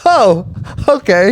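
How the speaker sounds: small, high-pitched hurt acceptance; high little reply, taken aback; stung, deflated reaction. Retold or imitated. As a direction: imitate taking an insult meekly. Acting his stung reaction to insult.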